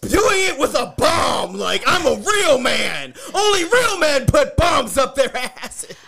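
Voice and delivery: loud, drawn-out macho boast; hammed-up he-man shouting, breaking into laughter; mock macho shout. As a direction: bellow it like a parody tough guy.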